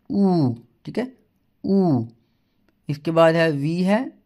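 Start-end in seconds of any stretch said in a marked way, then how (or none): 0.1-2.1 a long rounded ऊ, drawn out; German u sound. Shown as a demonstration. Teacher showing students the German letter U. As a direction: demonstrate the German U as ऊ. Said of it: "German में कहेंगे".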